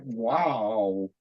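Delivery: low, soft, drawn-out wow; calmed awe. Retold or imitated. Man soothed by a wonderful gentleman's touch, calmly awed. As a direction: sink into quiet amazement. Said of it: "and calm down immediately".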